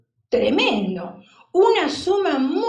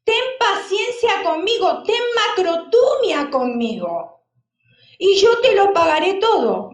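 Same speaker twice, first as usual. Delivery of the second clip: a mildly raised plea for patience; pleading servant; 5 semitones higher, 4 dB louder than usual. Acted. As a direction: beg the master for more time, only lightly acted. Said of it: "le rogaba diciendo".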